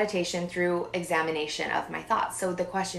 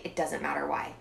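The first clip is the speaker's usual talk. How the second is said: soft, low, calm; calm reassurance; 4 semitones lower, 6 dB quieter than usual. Retold or imitated. Husband calmly coaching anxious wife, soft. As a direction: settle her down gently.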